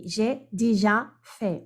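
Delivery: J'ai déjà fait, slow and deliberate; slow model sentence. Shown as a demonstration. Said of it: "Ou par exemple".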